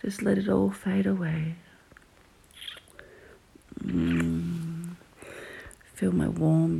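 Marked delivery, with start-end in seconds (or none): 0.0-5.0 slow, close whisper in the ear; right in your ear. Acted. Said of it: "My voice in your ear like this".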